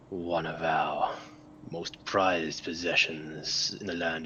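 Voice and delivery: slow, half-whispered demonic voice. Acted. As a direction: breathe it out slowly like a demon from beyond. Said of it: "this weird demonic voice".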